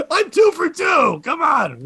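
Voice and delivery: high shouted boast; crowing triumphantly. Real, not acted. Comic boasting to co-host, high triumphant shout. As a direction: shout it like you just scored twice. Crowing over two improvised songs.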